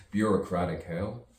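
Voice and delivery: usual speaking voice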